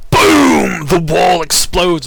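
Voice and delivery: huge high shouted boom; booming explosion. A narrator's reading. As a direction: shout it out like a blast, stretched long. Narrator voices the exploding wall.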